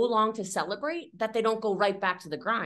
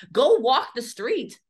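normal talking voice

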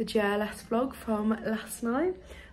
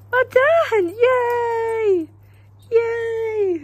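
much higher, much louder gleeful yay; excited cheering; 13 semitones higher, 11 dB louder, much slower than usual. Real, not acted. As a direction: cheer like you just won for the dog. Celebrating with the dog after walk.

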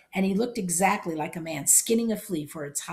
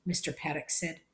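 plain reading voice

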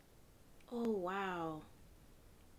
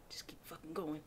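whispering to himself to keep moving; tense whisper; 5 semitones lower, 13 dB quieter than usual. Real, not acted. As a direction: breathe it out, no voice. Fleeing something in the dark.

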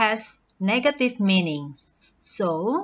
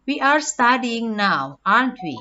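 "aren't we" dropping in pitch at the end; falling intonation. Shown as a demonstration. Teacher seeking agreement from the hearer, falling intonation on "aren't we". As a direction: confirm it, letting "aren't we" fall, sure of the answer. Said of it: "Falling intonation is used when the speaker wants to confirm".

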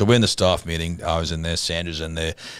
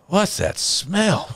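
much higher, very slow, wrinkled-nose asking; sniffy pastor's wife; 13 semitones higher, much slower than usual. Retold or imitated. Pastor's wife asking the staff, high and drawn out. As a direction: draw it out high, sniffing round the room. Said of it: "she goes".

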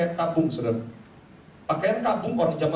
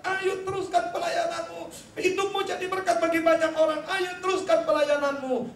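wife coaxing; 10 semitones higher than usual. Retold or imitated. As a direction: gently urge him to keep going. Wife coaxes depressed Luther.

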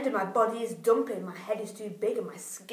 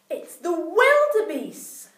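dramatic reveal; 6 semitones higher, 8 dB louder, much slower than usual. A narrator's reading.

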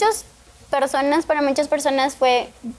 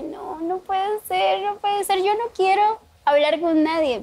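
tearful refusal; 5 semitones higher than usual. Retold or imitated. Crying teen refusing to talk to the pastor. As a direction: plead to be left alone, crying. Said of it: "porque yo estaba enojada y estaba llorando".

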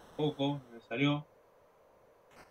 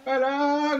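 excited shout; 13 semitones higher, 7 dB louder than usual. Real, not acted. Last-chance free kick goes in.

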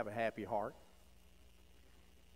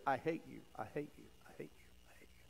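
fading echo; 3 semitones lower, 11 dB quieter, faster than usual. Retold or imitated. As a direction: echo dying away to a whisper. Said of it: "an echo came back".

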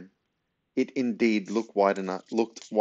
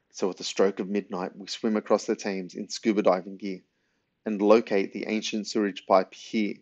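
ordinary delivery, nothing marked

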